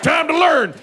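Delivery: high, loud military shout; mock commando bark. Navy seals bursting in on him, loud commando bark. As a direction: yell it like a Navy seal storming in. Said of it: "be like".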